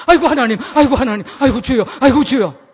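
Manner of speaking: loud hammed-up panic cries to God; comic frightened outcry; mock terrified wailing. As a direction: wail like a passenger in a speeding taxi. Passengers terrified in speeding taxi.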